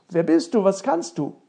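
loud, astonished questioning; exclaimed questions. Acted. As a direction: fire both questions out loudly. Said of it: "rief der Prinz nun aus".

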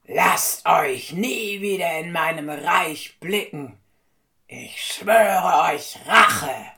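enraged king yelling after escaping thieves; furious king shouting. A narrator's reading. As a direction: shout a vow of revenge. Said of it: "Man hörte nur noch den König schreien".

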